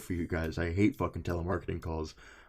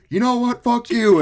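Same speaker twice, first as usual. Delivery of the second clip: high, loud retort; yelling back; 11 dB louder than usual. Retold or imitated. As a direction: snap back with a loud yell. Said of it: "And I was like".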